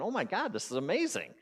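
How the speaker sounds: swooping high, delighted wonder; happy little kid. Retold or imitated. Barefoot man's childlike part feeling grass, delighted. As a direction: gush like an overjoyed child. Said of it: "feeling like this little kid".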